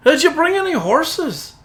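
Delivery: high, rapid-fire protest from the driver; objecting driver. Acted. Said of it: "but he's like".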